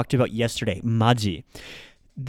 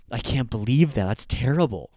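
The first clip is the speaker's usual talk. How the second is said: usual speaking voice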